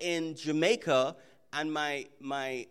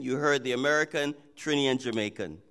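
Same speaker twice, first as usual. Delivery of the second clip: ordinary talk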